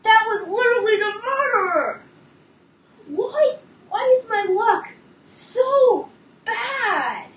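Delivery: high and loud, on the edge of screaming; real exasperated near-scream; frustrated outburst. Real, not acted. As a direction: vent the bad luck at full volume. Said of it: "not to scream right now".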